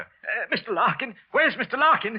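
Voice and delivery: high, frightened cry for help; frightened call. Acted. Wilson calling for his boss in fright. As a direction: call out in alarm.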